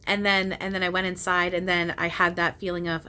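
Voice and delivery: ordinary delivery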